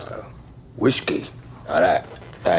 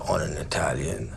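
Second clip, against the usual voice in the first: hushed, chilling aside; menacing whisper; 4 semitones lower, 6 dB quieter than usual. Acted. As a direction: drop to a whisper on the victim. Telling his war atrocity.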